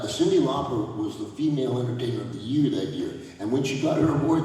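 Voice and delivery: normal talking voice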